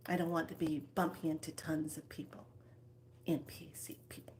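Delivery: fast conspiratorial whisper. Pretend. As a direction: hurry it out under your breath. Imagining a quiet shop.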